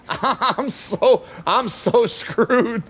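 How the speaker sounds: laughing through the words, slowly. Real, not acted. Streamer laughing at himself after cussing on stream, giggling. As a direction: giggle helplessly at your own slip. Laughing at his own slip.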